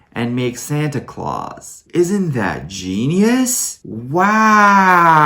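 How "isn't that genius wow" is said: slow, drawn-out mock awe, rising into a big wow; sarcastic amazement. Reviewer mocking Santa's silly full name, sarcastic amazement. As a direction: gush with fake wonder, stretching it out.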